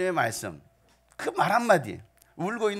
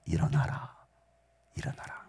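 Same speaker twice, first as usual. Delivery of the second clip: soft command; 12 semitones lower, 6 dB quieter than usual. Acted. Quiet retelling of command.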